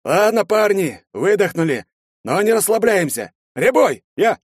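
rally the men loudly; командный окрик. A narrator's reading. Командир подбадривает отряд. (командир громко собирает отряд)

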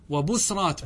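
وبسرات said "wa-busrāt", no vowel after the s; sukun on the sin. Shown as a demonstration. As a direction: close on s in وبسرات, bus-rāt. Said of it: "بالسكون". (وبسرات بسكون السين)

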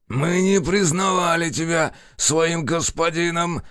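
рычит от боли и злости; прорычать раненым голосом; рык. A narrator's reading. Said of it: "прорычать лежащий на полу". (злобный рык)

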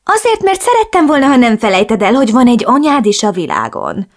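a hint of a reproachful mother. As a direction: reproach her daughter in a lilting mother voice. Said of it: "mondta szemrehányóan".

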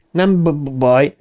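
halting stammered answer; stuttering. A narrator's reading. Bill answers Richie, stammered reply. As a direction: catch repeatedly on the b before releasing the word. Bill's stutter acted in narration.

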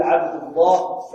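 عبد الله with u before a thick, heavy L; slow, loud عبد الله with a velarized lam after u; heavy lam after damma. Shown as a demonstration. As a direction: say عبد الله as "abdu-LLAAH", slowly, lam full. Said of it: "وفخم اللام من اسم الله".

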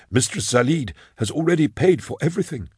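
formal lilting undertaker, reading down the list; solemn obliging undertaker. Acted. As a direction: recite the arrangements with deferential care. Slender undertaker answers customer.

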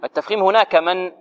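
ordinary delivery

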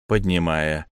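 ordinary delivery

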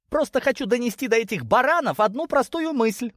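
high-pitched, impatient insistence; exasperated daughter. Acted. Daughter explaining to his lordship about stubborn rams, exasperated. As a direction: drive the point home, pitched high.

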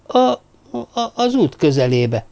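ordinary delivery, nothing marked

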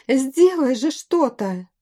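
high, drawn-out desperate demand; wailing plea. A narrator's reading. Woman begging the bandit leader to act, crying out. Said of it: "завопила женщина".

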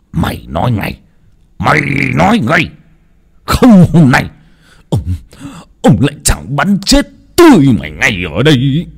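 angry father escalating into a shout; furious threat. Acted. Gun-wielding father threatens son.

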